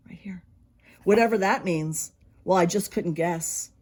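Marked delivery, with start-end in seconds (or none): 0.1-0.4 whisper. Real, not acted. Pointing at the word, hushed.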